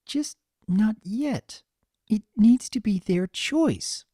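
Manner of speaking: gently, hesitant and shy; lightly voiced timid Fluttershy.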